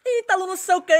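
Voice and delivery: high, eager voice calling his name; excited student girl. Retold or imitated. Gym student greets her trainer, high and eager. Student recognizes him at gate.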